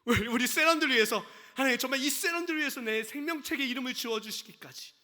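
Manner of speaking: loud impassioned prayer, rising, laughing at the peak; loud, laughing preacher praying for the saints; fervent preaching. Acted. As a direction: pour it out like a pleading prayer.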